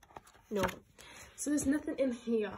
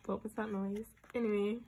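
usual speaking voice

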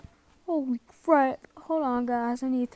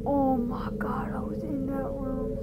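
scared whisper; 5 dB quieter than usual. Real, not acted. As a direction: whisper it so it can't hear. Hiding from monster.

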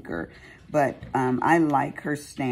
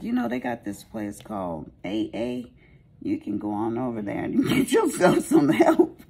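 a lightly put-on teasing voice; teasing retort; 5 semitones higher, 4 dB louder than usual. Teasing a hard-drinking client about AA, a lightly put-on voice. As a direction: needle her jokingly about AA. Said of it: "And I was like".

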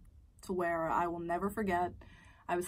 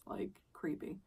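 whisper; 9 dB quieter, slower than usual. Real, not acted. Aside to viewers.

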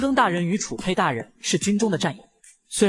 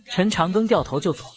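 usual speaking voice

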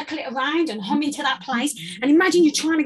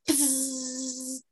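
a softer, close little bee buzz; 8 dB quieter than usual. Shown as a demonstration. Coach showing a close, soft bee buzz. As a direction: buzz gently, the bee is right at your finger. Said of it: "a bee sound like this".

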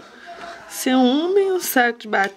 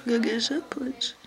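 whispering; 7 dB quieter, slower than usual. Real, not acted. Quiet aside during the procedure.

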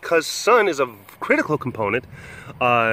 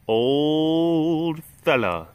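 very slow, stretched coo; drawn-out coo; 3 semitones higher, much slower than usual. Real, not acted. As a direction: draw it out lovingly.

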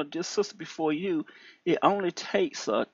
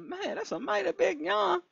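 amazed remark; 13 semitones higher, 3 dB quieter than usual. Retold or imitated. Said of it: "I said".

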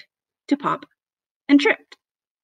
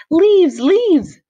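high, loud, excited shout; thrilled storybook frog exclamation; excited cry; 9 semitones higher, 9 dB louder than usual. A narrator's reading. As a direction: cry out with delight, voice leaping up. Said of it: "cried Froggy".

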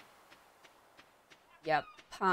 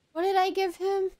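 much higher, squeaky puzzled voice; puzzled squeak; 13 semitones higher than usual. Real, not acted. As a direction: squeak the question in confusion. Puzzled squeak then plain repeat.

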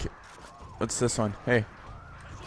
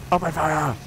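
lightly put-on commander, pitched up; mock battle order; 4 semitones higher than usual. Gamer playing at ordering troops to attack, mock commander.

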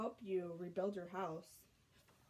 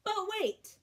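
sudden high exclaim; surprised; 9 semitones higher, 5 dB louder than usual. A narrator's reading. Reader halting the story with a sudden high exclaim. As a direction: stop the listener short. Narrator's dramatic interruption.

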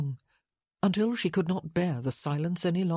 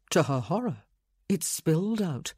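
ordinary delivery

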